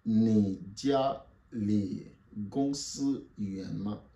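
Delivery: very slow, word by word; teacher spacing out each syllable; slow model sentence. Shown as a demonstration. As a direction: spell it out slowly for learners. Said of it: "Répétez après moi".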